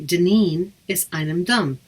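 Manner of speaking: slow, careful Irish "Deneen is einem Dum"; teacher modelling "Deneen is einem Dum" slowly; Irish phrase demo. Shown as a demonstration. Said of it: "I would say".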